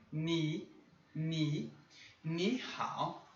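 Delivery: Ni said in a rising second tone; Mandarin tone demo. Shown as a demonstration. Teacher modeling the tone change on ni. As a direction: lift Ni upward, then ni hao. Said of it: "o tom de ni ... muda pro segundo".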